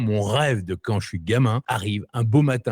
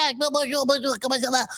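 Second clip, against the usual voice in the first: much higher nasal-free comic squeak, a bit softer; excitable French comedy-star greeting; De Funès impression; 14 semitones higher, 3 dB quieter than usual. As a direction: chirp the greeting like a fussy comic actor. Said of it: "la voix de ... De Funès".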